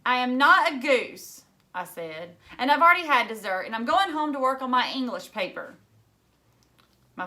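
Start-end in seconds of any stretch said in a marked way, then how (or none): 0.1-5.8 indignant. A narrator's reading. Sal snaps back at father.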